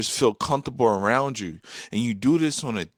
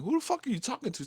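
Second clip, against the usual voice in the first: high, quick, incredulous retort; mock mad; 4 dB quieter, faster than usual. Boyfriend retorting to girlfriend, high, quick mock anger. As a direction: rattle it off in high mock indignation. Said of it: "used to really make me mad".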